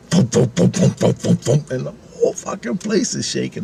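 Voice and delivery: rhythmic helicopter blade thumps; mouth-made helicopter rotor noise; imitated rotor thump. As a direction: puff out steady chopper beats. Imitates rotors over his head.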